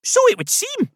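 very high, loud Scottish teddy bear; high-pitched Scottish character voice; Scottish accent. A narrator's reading. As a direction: answer in a lifted Scottish voice. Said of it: "a voice with a soft Scottish accent".

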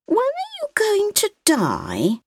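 put-on high, slow small-child voice; a curious little girl asking; little girl's voice. A narrator's reading. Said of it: "the child asked".

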